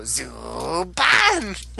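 drawn-out imitation of a gadget announcer; Mobirates' call. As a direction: stretch it like a transformation call. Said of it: "hear the Mobirates go".

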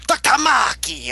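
high, slow, drawn-out Doctor Maki; imitating his call. Fan imitating how the actor calls out the name. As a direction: sing out the name like the show does. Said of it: "I love how he says that".

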